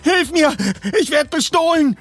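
very high, urgent scream for help; panicked cry. A narrator's reading. Woman being robbed shouts to Justus, panicked. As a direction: cry out in alarm.

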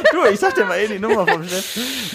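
high, laughing through the words. Real, not acted. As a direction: giggle out the joke about the boss. Laughing at the boss's number joke.

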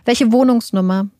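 ordinary delivery, nothing marked

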